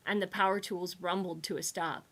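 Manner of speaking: usual speaking voice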